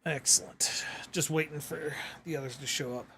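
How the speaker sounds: whispered under breath. Real, not acted. Host repeats it under breath.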